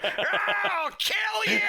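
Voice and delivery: very high, shrieking mock threat; furious kid shout. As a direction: scream the threat like an enraged kid. Wolf lunges at Fox.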